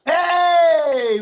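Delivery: long, high, loud hey; loud greeting shout. Real, not acted. Comic greeting the audience on taking the mic, shouted high. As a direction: shout a drawn-out hello to the crowd.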